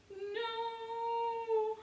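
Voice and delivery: very high, loud sung-out wail; a small girl breaking into crying. A narrator's reading. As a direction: wail in tears for the dog. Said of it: "Honey began to cry".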